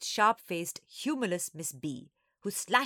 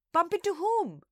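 put-on very high, loud child's squeak; excited squeaky question; 12 semitones higher, 6 dB louder than usual. A narrator's reading. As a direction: pipe up as a nosy little sister. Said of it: "Nana squeaked".

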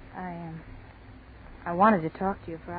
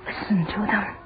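urgent fear; 4 dB louder than usual. Acted. Terrified girl urging friend to listen.

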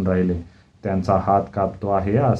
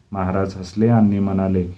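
ordinary delivery

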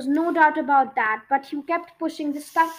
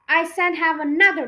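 sharp, louder headmistress command; sharper edge; 6 dB louder than usual. A narrator's reading. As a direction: cut her words with a harder edge. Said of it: "sharper edge to her voice".

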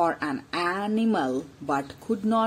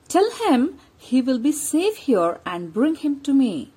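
commanding lion, raised and loud; 6 semitones higher, 5 dB louder than usual. Acted. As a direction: speak as the king of the forest. Lion gives orders to assistants.

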